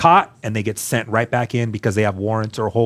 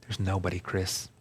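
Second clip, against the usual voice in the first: much softer, flat with disappointment; hushed letdown; 4 semitones lower, 13 dB quieter than usual. Real, not acted. Telling Chris nobody came to get him, hushed and low. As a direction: let the words sink, almost under breath.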